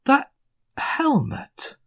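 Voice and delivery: high, astonished dwarf voice; dwarf worker surprised by the helmet. Acted. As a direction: gasp it out in disbelief. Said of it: "in surprise and goes".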